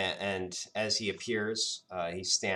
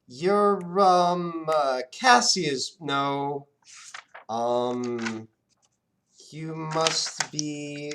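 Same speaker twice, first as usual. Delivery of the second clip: higher, louder, searching genie; puzzled genie; 7 semitones higher, 7 dB louder, much slower than usual. Acted. Genie struggling to recall the boy's name, high and searching. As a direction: grope for a forgotten name.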